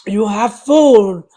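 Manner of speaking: slow, loud, stern: You have food; stern scolding. Real, not acted. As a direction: drag it out sternly at the dog. Scolding dog again, slower, louder.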